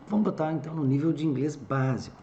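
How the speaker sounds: ordinary talk, nothing marked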